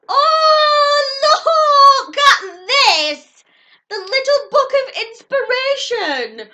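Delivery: high, loud squeal, slowed down; overjoyed squeal. Real, not acted. Unwrapping a little book of quotes she loves, an overjoyed squeal. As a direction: shriek with joy at the gift. Thrilled finding an inspiration book.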